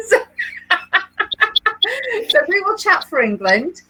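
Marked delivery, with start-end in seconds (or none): 0.2-2.2 laughter. Real, not acted. Laughing at married joke.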